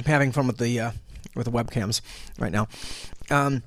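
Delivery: chewing while talking; mouth full. Real, not acted. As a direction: speak through a mouthful of candy. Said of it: "talking with my mouth full".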